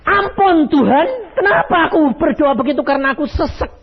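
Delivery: high, loud, startled cry for mercy; startled plea. Retold or imitated. Servant startled by God's answer, high shocked plea. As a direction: beg God in sudden shock. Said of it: "Kaget dia".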